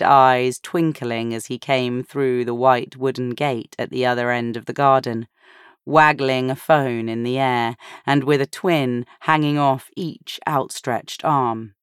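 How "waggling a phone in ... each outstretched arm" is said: ordinary delivery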